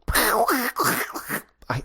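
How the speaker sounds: unvoiced duck squawk. Shown as a demonstration. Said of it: "I'm just doing the classic noise".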